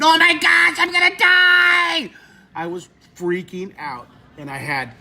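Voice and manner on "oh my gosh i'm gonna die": high, loud panicked scream; reenacted full-out terrified scream. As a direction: scream it as if about to die. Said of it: "I started screaming".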